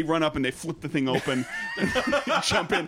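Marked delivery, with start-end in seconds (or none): 1.6-2.9 laughing. Real, not acted. Laughing through a funny story.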